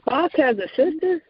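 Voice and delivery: high, incredulous question; surprised question. Real, not acted. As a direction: ask it in real surprise, pitch shooting up. Listener just learns a surprising fact.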